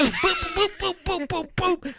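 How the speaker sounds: high laughing boop-boop noises; mock button beeps. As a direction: tap out the buttons playfully. Imitated button pressing noises.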